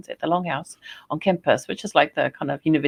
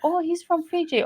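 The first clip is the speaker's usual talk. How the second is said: quoted reply; 13 semitones higher than usual. Retold or imitated. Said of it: "I'm like".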